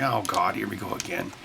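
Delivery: low, deflated, here-we-go-again sigh of the crew; mock exasperated mumble, trailing off; tired crew member dreading another lecture; weary groan. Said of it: "everyone's like".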